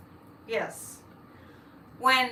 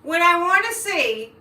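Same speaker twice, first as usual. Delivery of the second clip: rant; 5 semitones higher, 6 dB louder than usual. Real, not acted. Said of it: "I feel a rant coming on".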